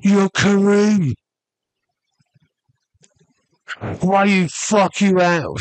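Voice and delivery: high, drawn-out call to someone; imitated shout. Big guy hailing Kareem across a club, imitated shout. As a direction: call out slowly, pitched well up.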